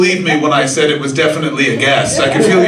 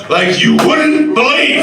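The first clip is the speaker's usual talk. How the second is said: knowing confession; 10 semitones higher, 4 dB louder, slower than usual. Retold or imitated. Mimicking guests admitting disaster.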